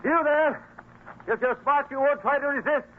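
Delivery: loud high commanding call. Acted. Father calls up to the trapped thief, loud command. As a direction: call across the hill to stop him.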